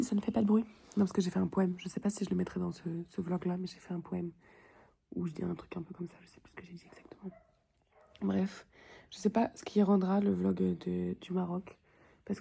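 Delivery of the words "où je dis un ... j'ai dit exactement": whispering. Real, not acted. Whispered trailing aside.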